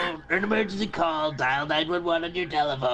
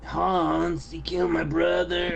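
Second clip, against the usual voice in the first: ordinary talk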